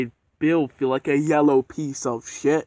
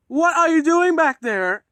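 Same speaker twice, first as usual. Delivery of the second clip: higher, louder, slow stern demand; 14 semitones higher, 5 dB louder than usual. Acted. Edward sternly challenging the trucks pushing behind him, stern. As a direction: call back sternly, warning them off. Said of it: "with the stern fucking look".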